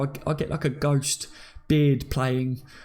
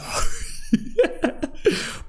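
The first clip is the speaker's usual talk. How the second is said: breathless high-pitched laugh; wheezing, silent laugh; high unvoiced laugh; 14 semitones higher than usual. Real, not acted. Laughs at geezer look.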